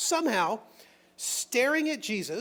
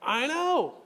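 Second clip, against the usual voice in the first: exasperated mock "I know"; 6 semitones higher, 4 dB louder than usual. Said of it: "you're gonna say".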